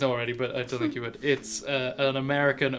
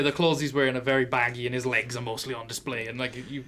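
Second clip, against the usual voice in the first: usual speaking voice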